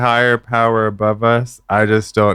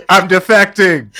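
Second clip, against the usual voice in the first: laughing hard; 4 dB louder than usual. Real, not acted. Bursts out laughing at joke.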